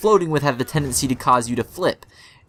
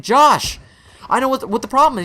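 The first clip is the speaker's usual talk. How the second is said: retold confrontation; 9 semitones higher, 3 dB louder than usual. Acted confrontation with rafting partner.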